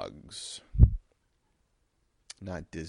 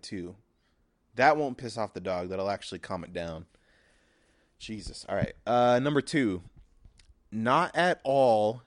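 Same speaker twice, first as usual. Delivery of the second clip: normal talking voice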